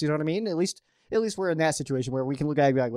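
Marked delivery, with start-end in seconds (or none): none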